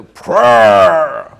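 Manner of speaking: stretched Irish "prayer", falling away; imitated Irish accent. Preacher showing off the Irish word he loves, imitated accent. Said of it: "then the way you say".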